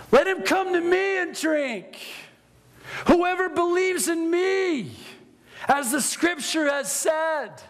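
high ringing shout of a preacher; a mock Jesus, shouting to the temple crowd; loud voice. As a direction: proclaim it, crying out to the crowd. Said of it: "it says in a loud voice".

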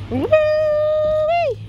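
high, sustained "woo-wee"; long high-pitched whoop; excited whoop. Real, not acted. Cheering child down twisty slide.

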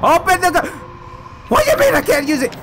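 high loud frantic yelling; frantic shout. Real, not acted. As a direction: yell in panic. Frantic at a locked door.

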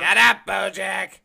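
slow, loud snap of a yell; fed-up shout; angry shout. Acted. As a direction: bark it at the pest to silence him. Yelling at a noisy pest.

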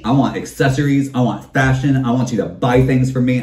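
usual speaking voice

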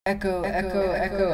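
cave echo. Shown as a demonstration. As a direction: echo repeats fading to quiet. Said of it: "Think of it as delay is shouting into cave".

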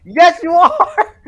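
high, loud, laughing shout; gleeful loud tease; laughing yell. Real, not acted. Teasing banter among friends.

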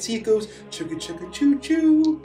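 train noise. Acted. Imitates toy train sound.

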